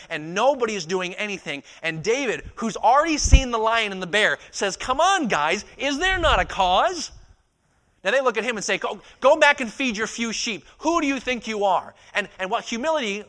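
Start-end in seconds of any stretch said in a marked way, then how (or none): 4.8-7.1 high, loud appeal to the troops; impassioned, slow, high shout; rallying cry. Acted. Young David rallying the soldiers. 8.8-11.9 loud, high dismissive telling-off; acted scorn, raised voice; scornful rebuke. Soldiers rebuking young David.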